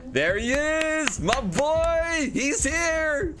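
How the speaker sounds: high, drawn-out joyful cry; excited. Real, not acted. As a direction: greet him like a long-lost friend. Greeting new automaton excitedly.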